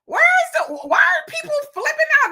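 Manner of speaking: high, loud disbelieving yell; incredulous shout. Pretend. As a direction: throw your hands up and shout. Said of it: "because I'm like".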